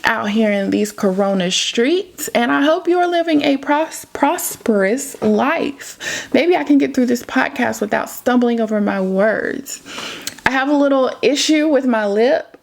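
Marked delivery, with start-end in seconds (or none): none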